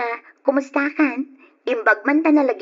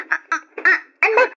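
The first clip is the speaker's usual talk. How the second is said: pained stammer; 8 semitones higher, 5 dB louder than usual. Acted. Labor pain hits.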